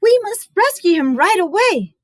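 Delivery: high, urgent mother; rally everyone, high and urgent. Acted. Mother hearing the news, high urgent voice. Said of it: "Chen's mother said".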